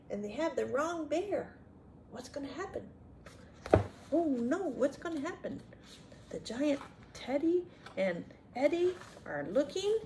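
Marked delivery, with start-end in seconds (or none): none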